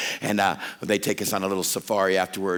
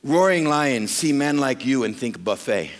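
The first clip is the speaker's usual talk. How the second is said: louder, slow deadpan delivery; slow punchline; 6 semitones higher, 5 dB louder than usual. Retold or imitated. Safari guide warns the preacher about lions, slow punchline. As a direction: land the buffet line slowly. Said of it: "Then he said this".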